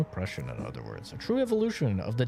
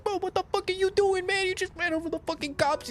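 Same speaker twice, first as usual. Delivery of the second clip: much higher, fast, exasperated friend yelling at him; Lamar's voice; faster than usual. Retold or imitated. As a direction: squeal it out like a panicked buddy. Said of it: "and Lamar's like".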